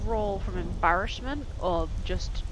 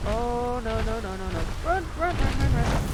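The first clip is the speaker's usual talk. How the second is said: loud frantic run run run; panicked shouting; 8 dB louder than usual. Real, not acted. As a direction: shout urgently while fleeing. Fleeing from enemies.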